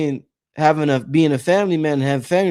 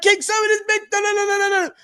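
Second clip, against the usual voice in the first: much higher, squeaky mocking rant voice; mock high-pitched rant; faster than usual. Retelling a call to King Simon, in a mock squeaky rant. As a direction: imitate his own younger self venting, comically high. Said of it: "I'm in my twenties, like".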